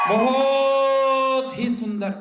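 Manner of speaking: slow, stretched admiring words; drawn-out praise. Real, not acted. Comedian praises a pretty girl, drawn out slowly.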